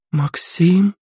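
put-on a girl just woken, unsure; sleepy princess. A narrator's reading. Princess Anya wakes and sees Maxim, unsure.

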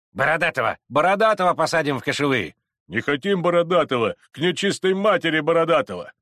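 forceful cries from rival factions; crowd shouting. Acted. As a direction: call out over a quarrelling mob.

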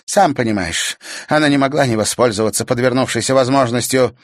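high, loud and fast; hurried explanation; urgent young witch. A narrator's reading. (торопливое объяснение)

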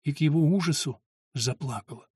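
plain reading voice

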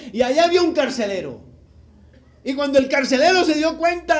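loud, climactic sermon telling of the earthquake; fiery preaching. Acted. Pastor thundering the jailbreak story to the congregation. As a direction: build it up like a revival preacher.

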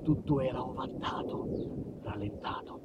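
normal talking voice

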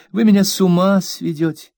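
high, aggrieved plea; mother scolding her maid, high and hurt; wounded young mother. A narrator's reading. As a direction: play the hurt, fragile mistress. Mother voiced in hurt quarrel.

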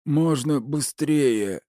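high, drawn-out urgent call; impatient plea, stretched high; urging someone on. A narrator's reading. Urgent line voiced in story.

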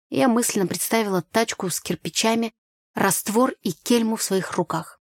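ordinary delivery, nothing marked

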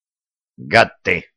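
low slow grumble; grumbling king. A narrator's reading. King's mock grumble.

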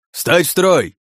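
high, loud yelled command; yelled order. Acted. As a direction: bellow at the troops to fall in. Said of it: "орал предводитель демонов".

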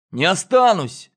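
put-on high defiant boy's retort; stubborn child talking back. Boy refuses defiantly.

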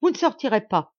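put-on a young bride blocking her husband's way, exasperated; exasperated cry. A narrator's reading. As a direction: forbid him to leave. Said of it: "s'écria-t-elle exaspérée".

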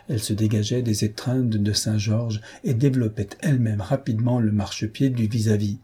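ordinary delivery, nothing marked

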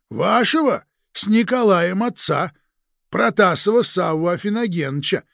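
ordinary delivery

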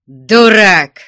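loud, high burst of anger; angry shout. A narrator's reading. Chapurin boiling over at Vasily's refusal, angry shout. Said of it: "крикнул вскипевший гневом Чапурин".

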